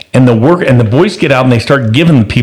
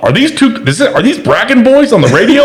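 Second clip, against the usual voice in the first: high-pitched imitation of astonished callers; can't believe what's on the radio; put-on incredulous, excited squeal; amazed caller; 10 semitones higher than usual. Amazed phone callers acted out.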